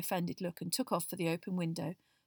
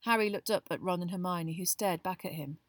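usual speaking voice

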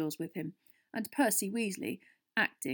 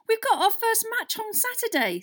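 high, loud indignant protest; outraged; 8 dB louder than usual. A narrator's reading. Harry objecting to losing Quidditch practice, outraged.

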